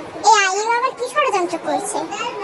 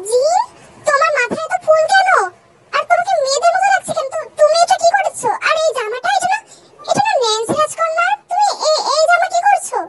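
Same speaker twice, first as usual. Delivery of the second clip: higher-pitched, baffled questioning; bewildered visitor; 6 semitones higher than usual. Acted. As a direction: question the prince in raised-pitch disbelief. (অবাক হয়ে চড়া সুরে প্রশ্ন)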